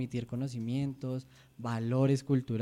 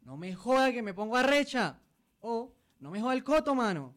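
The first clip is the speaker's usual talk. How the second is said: hammed-up Santander anger, pitched high; Santander accent; 9 semitones higher than usual. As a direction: snap it in a rough Santander accent. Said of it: "Se dice".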